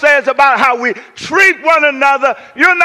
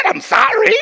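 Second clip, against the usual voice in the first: higher, impatient repeat of sorry; 8 semitones higher than usual. Shown as a demonstration. Preacher annoyed the wounded man won't get up, impatient. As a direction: say it again, annoyed he isn't up. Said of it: "I don't know why you're not getting up".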